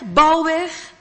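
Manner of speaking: plain reading voice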